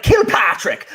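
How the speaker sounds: high, drawn-out mock Irish name call; Irish accent. Shown as a demonstration. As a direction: sing out the name in hammed-up Irish. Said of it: "I can't say your name without".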